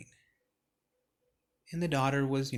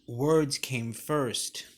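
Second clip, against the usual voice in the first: slow emphasis; 4 dB louder, much slower than usual. Shown as a demonstration. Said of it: "stressing the sermon point".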